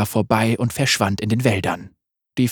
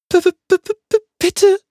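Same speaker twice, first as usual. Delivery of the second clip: hoch und laut gestottert vor Angst; ängstliches Stottern; 5 dB louder than usual. Acted. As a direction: die Worte bleiben im Hals stecken. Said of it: "stotterte Magga". (Magga stottert verängstigt, viel höher)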